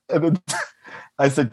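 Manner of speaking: usual speaking voice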